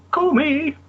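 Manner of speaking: squeaky high call me; silly high-pitched voice; falsetto. Pretend. Playful sign-off in falsetto.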